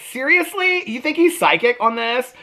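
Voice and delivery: high-pitched incredulous question; mock disbelief. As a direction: retell it incredulously, voice shooting up. Retold disbelief at gullible believers.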